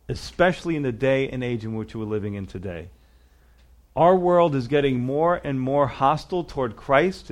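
ordinary talk, nothing marked

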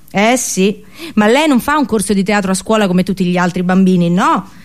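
ordinary delivery

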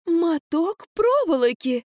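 high, slow disbelieving echo; stunned disbelief. A narrator's reading. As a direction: repeat it, utterly stunned. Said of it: "с непередаваемым выражением повторила Марфа".

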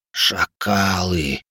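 hoarse, very slow dying rasp; deathbed whisper. Acted. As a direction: rasp it out with last breath. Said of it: "хрипло прошептал умирающий".

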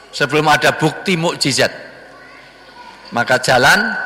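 usual speaking voice

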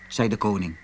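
ordinary delivery

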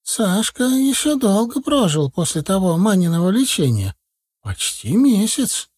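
put-on high, chattering old granny voice; old village woman. A narrator's reading. Старушка в сером платке жалуется на деда, высокий старческий голос. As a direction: tell it like a fussy village grandma.

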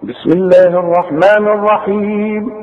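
slow measured chant with long held vowels; Quran recitation. Shown as a demonstration. Teacher replays what was read for students, Quranic chanting. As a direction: recite slowly, drawing out each verse ending. نسمع بقى اللي قريناه.

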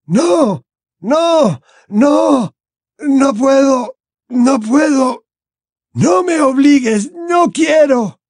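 very high, anguished cries; desperate old man wailing refusals; screaming. A narrator's reading. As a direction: cry out in torment, stretching the words. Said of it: "El anciano gritó".

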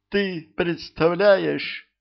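put-on high, slow, breathless young girl; young thief telling her knight the news, high and girlish. Girl breaking bad news.

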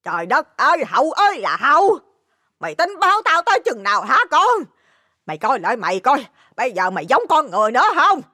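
high-pitched, exasperated scolding; mother's high, outraged lament; angry outcry. Acted. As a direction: wail at your drunken son in fury. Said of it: "Bà tức trung người, la lên".

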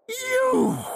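very high, drawn-out snarl. A narrator's reading. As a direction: snarl the word high in outrage. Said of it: "he snarled".

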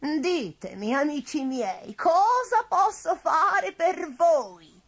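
slow witch voice, swelling high and loud; generous old witch. A narrator's reading. Witch asking her friends what she can do, effusive. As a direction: stretch the offer out grandly.

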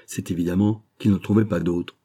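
ordinary delivery, nothing marked

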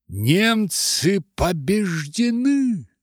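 high, slow, loud cry; bitter exclamation. Acted. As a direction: drag out the shocked cry of defeat. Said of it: "сказали гады".

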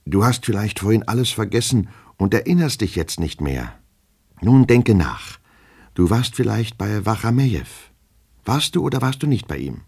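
high-pitched, pressing questions; urgent coaxing. Acted. Master urging his forgetful servant to remember, coaxing.

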